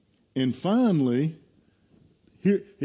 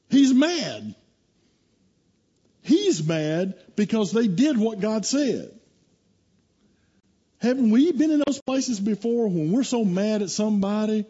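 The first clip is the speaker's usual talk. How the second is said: raised, driving sermon voice; fiery preaching; 7 semitones higher, 3 dB louder than usual. Acted. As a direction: preach it with fire to the congregation. Preaches the Jonah story at full force.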